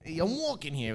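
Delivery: mock New York street shout; New York accent. Shown as a demonstration. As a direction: protest like an annoyed New Yorker. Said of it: "That's New York".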